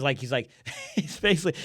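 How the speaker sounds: ordinary talk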